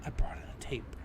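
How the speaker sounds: unvoiced whisper. Answering Ron in a whisper, unvoiced.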